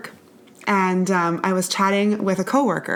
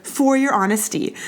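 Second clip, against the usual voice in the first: high coworker voice; 8 semitones higher, slower than usual. Retold or imitated. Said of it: "she was like".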